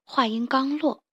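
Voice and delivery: usual speaking voice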